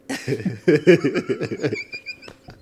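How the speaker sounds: high, breaking-up giggle; laughing. Real, not acted. Host reading out an odd film title, giggling. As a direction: giggle helplessly at your own joke.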